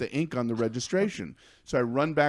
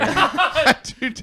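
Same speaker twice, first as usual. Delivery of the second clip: laughing; 13 semitones higher, 6 dB louder, slower than usual. Pretend. Mock vow dissolving into laughter.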